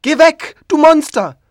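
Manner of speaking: put-on high, loud child's scream; frightened child screaming at the monster. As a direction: shriek it like a terrified little boy. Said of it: "Es schreit und schreit".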